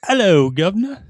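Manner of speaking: put-on over-the-top British accent, loud and high; English accent. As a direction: belt out a bad Cockney greeting. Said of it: "Do your, do your English accent".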